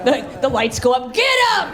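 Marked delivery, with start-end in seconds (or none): 1.1-1.7 high, sharp command; sudden order. Acted. Lights go up on the audience, high sharp command. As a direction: call it out high, as if rounding people up.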